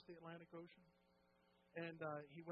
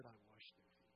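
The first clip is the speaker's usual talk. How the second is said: hushed humble confession; 7 semitones lower, 13 dB quieter than usual. Retold or imitated.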